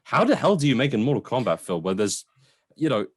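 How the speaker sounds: ordinary delivery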